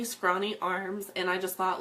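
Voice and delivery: ordinary delivery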